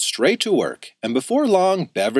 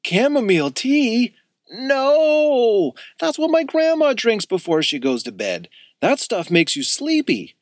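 shocked; 10 semitones higher than usual. A narrator's reading. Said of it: "Axel practically spit his drink out".

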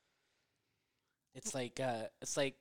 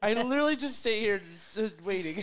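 mock innocent; 10 semitones higher, 13 dB louder than usual. Real, not acted.

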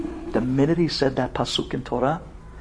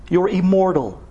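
normal talking voice

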